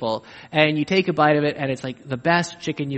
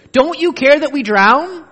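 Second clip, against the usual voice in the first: higher, louder frightened plea; panicked disciples; 11 semitones higher, 10 dB louder than usual. Retold or imitated. Disciples in the boat plead with Jesus, higher and louder. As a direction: cry out over the storm. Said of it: "the same thing the disciples said".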